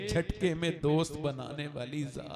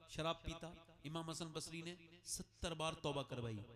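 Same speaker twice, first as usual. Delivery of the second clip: hushed story narration; 15 dB quieter than usual. Acted. Preacher telling the congregation the sinful son's story, hushed. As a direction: draw them in close and quiet.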